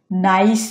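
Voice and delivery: নাইস ending in a clear separate s; unassimilated wrong take. Shown as a demonstration. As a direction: keep a crisp s at the end of নাইস, not sh. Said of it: "হচ্ছে না".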